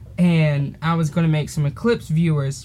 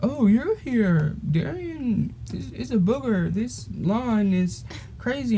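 put-on old lady; 6 semitones higher, slower than usual. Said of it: "she was like".

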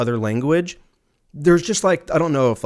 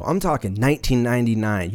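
ordinary talk

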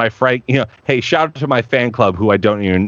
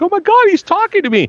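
imitating the fan's thrilled squeal, high and fast; excited gasp; faster than usual. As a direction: squeal like a starstruck fan. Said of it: "She's like".

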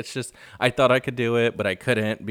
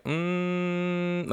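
long, high, quiet frustrated "mm"; frustrated hum; 7 semitones higher, 5 dB quieter than usual. Retold or imitated. Kathy just looking at Rinna, frustrated hum. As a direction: imitate a held-back, frustrated hum, high and soft. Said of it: "she was frustrated, you could tell".

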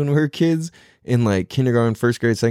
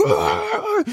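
imitated wrestler yell. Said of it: "he's just like".

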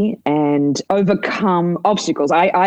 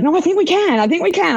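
higher and brighter, insistent; eager reply; 12 semitones higher than usual. Retold or imitated. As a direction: imitate Gab pushing back excitedly. Quoting Gab's confident reply.

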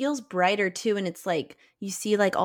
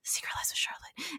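whispered film-quote impression; mock movie whisper; 9 semitones higher, 13 dB quieter than usual. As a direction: whisper it like a secret clue. Re-enacting a film's awed discovery line.

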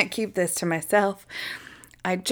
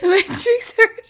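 high squeaky laughter through words; laughing hard; 9 semitones higher, 5 dB louder, slower than usual. Real, not acted. Co-host cracking up at the snake bit, laughing hard. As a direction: talk while helpless with laughter. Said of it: "I'm laughing so hard".

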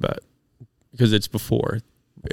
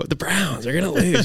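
imitated nervous voice, higher; mock his nervous voice, pitched up; 6 semitones higher, 4 dB quieter than usual. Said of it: "You, you used that voice before".

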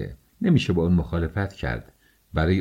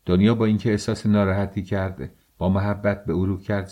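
ordinary delivery, nothing marked

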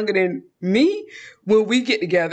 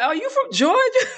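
high-pitched astonished question; squeaky-high mock surprise; surprised mimicry; 14 semitones higher than usual. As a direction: imitate an amazed stranger, pitched way up. Acting out surprised drive-through workers.

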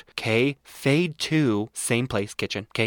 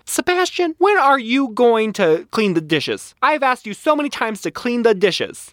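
mock nagging partner, much higher and louder; nagging spouse voice; 11 semitones higher, 4 dB louder than usual. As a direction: scold him about the dishes, way up high. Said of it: "He's like".

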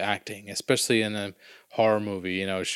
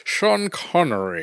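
slow, higher Scottish Connery voice, drawing out the name; Sean Connery Scottish impression; 6 semitones higher, slower than usual. Friend answers a podcast cohost with a slow Scottish Connery voice. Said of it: "There you go".

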